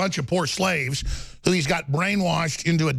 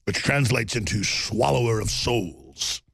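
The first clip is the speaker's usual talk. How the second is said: low, slow trailer voice; deep dramatic movie voice; 5 semitones lower, slower than usual. Pretend. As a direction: intone it like an epic villain intro. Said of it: "This is how Kim Jong-un would sound".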